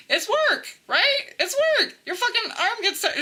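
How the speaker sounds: high squeaky complaint; exasperated high-voiced moan; comic griping. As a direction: complain high-pitched, as if worn out by chores. Comic griping about daily chores.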